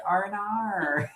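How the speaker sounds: high, drawn-out Australian "R and R"; exaggerated Australian accent. Shown as a demonstration. Showing friends how to overdo it, "R and R" in exaggerated Australian accent. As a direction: stretch "R and R" into a hammed-up Australian "oh no".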